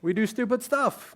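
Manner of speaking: ordinary talk, nothing marked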